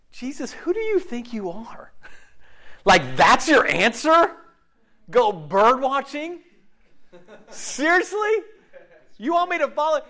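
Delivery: loud, high, disbelieving outburst; mocking disbelief, rising loud and high; incredulous. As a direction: scoff at the advice in shock. Incredulous retort to Jesus.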